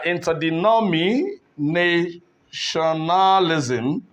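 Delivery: "interdenominationalism" slowly, pausing around na before tionalism; slowly sounded out. Shown as a demonstration. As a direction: draw out the later syllables of "interdenominationalism". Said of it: "if you take your time to split this".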